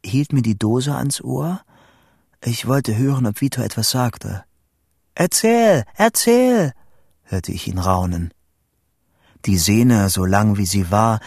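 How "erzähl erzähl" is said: high, eager calls; excited urging. A narrator's reading. Vito hungry for news down the line, excited urging. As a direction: beg eagerly for more, voice up. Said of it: "die Aufregung in Vitos Stimme".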